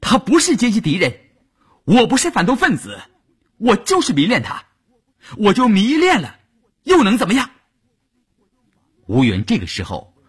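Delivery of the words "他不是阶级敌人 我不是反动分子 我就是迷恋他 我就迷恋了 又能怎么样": high-pitched, impassioned outcry; defiant yelling. A narrator's reading. Wife shouting back at her accuser, defiant. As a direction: yell back in fierce defense of him. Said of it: "乌云也对着他大叫".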